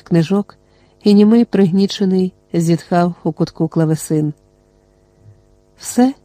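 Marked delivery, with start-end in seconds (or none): 5.8-6.1 disbelieving question, pitched higher; a high incredulous echo. A narrator's reading. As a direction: ask it back in disbelief. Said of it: "перепитав Орвін".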